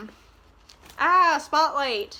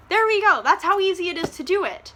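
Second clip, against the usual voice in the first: triumphant; 7 semitones higher, 6 dB louder than usual. Real, not acted. Triumphant after mocking Tessa.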